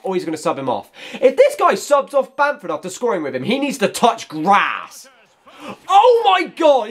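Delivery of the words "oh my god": high, slow, loud disbelief; shocked outburst. Real, not acted. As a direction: cry out in disbelief. Opponent subs on a better striker.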